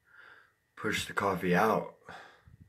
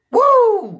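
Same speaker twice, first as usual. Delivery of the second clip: loud high whoop; hype yell; 15 dB louder than usual. Real, not acted. Hype yell before greeting.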